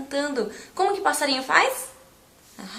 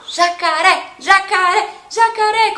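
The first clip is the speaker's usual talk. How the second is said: loud, high chant of jacaré; calling loudly; 7 semitones higher, 6 dB louder than usual. Shown as a demonstration. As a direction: call the alligator out loud. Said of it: "bem alto".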